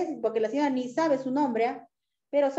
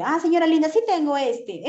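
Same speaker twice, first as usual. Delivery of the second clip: higher, louder, sweetened tone; sweet clerk voice; 5 semitones higher, 5 dB louder than usual. Shown as a demonstration. Clerk kindly offers another brand to a customer, sweet and high. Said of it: "hay formas de decirle".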